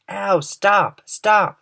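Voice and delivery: high, loud cries of pain; pained shouting. A narrator's reading. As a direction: beg them to stop, hurting. Centipede pelted by hail.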